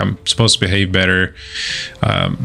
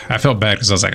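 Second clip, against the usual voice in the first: usual speaking voice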